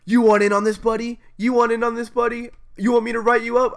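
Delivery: loud, high-pitched mock tough-teacher challenge; badass teacher. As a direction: imitate a teacher barking a cocky threat. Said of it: "like she's a fucking badass and goes".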